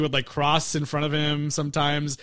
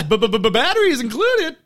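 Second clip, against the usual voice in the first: stammering; 8 semitones higher, 5 dB louder than usual. Retold or imitated. Reading box, stammer.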